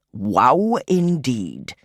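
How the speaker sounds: put-on very slow dry old man; slow mild wry godfather; dry old gentleman. A narrator's reading. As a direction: drag it out, amused. Daedalus voice.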